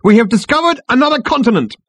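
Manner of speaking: high, loud military proclamation; ringing, high-pitched declaration; soldier's proud announcement. A narrator's reading. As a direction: shout the report across the parade ground. Soldier proclaims discovery to captain.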